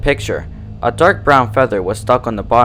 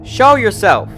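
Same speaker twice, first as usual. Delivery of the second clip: high, tense snarled demand; 10 semitones higher, slower than usual. A narrator's reading. Twilight demands the voice show itself, snarling. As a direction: bark the demand into the dark. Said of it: "Twilight snarled".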